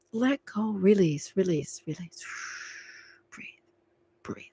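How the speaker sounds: soft, hushed, sinking to near-silence; soothing release. Real, not acted. Reader calming the client who puts too much in, soothing hush. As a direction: sigh it out and guide them to let go.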